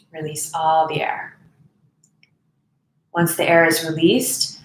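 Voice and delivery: even, softening voice with space to breathe; guided meditation. Real, not acted. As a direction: guide them unhurriedly through each breath.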